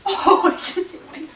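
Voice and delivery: real laugh through the words; laughing at herself while crafting. Real, not acted. Laughing at her own mishap.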